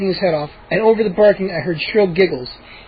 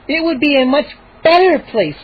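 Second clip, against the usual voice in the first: insisting; 7 semitones higher than usual. A narrator's reading. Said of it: "Minnie insisted".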